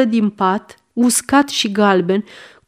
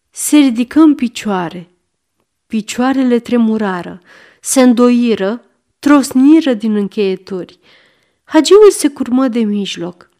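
plain reading voice

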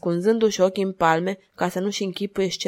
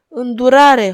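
loud, higher cry for mercy; gasped plea; 5 semitones higher, 10 dB louder, slower than usual. A narrator's reading. Tormented madwoman begging the duchess for mercy, anguished cry. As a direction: cry out as if in agony. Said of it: "horcăi nenorocita".

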